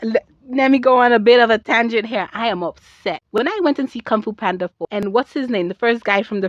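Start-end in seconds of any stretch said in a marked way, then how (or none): none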